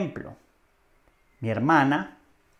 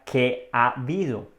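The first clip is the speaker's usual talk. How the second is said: palabras separadas. Shown as a demonstration. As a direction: spell out "¿qué ha habido?" as three separate words. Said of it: "Pero en realidad esto significa". ("¿qué ha habido?" despacio, palabra por palabra)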